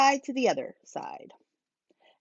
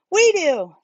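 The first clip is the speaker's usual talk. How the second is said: louder, higher eager shout; eager children; 8 semitones higher, 5 dB louder than usual. A narrator's reading. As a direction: kids calling out together. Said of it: "said the children".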